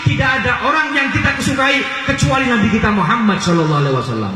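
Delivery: high, insistent preaching with hammered phrases; impassioned exhortation. Real, not acted. Preacher urging listeners to have no idol but the Prophet, impassioned. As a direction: drive each phrase home at a high pitch.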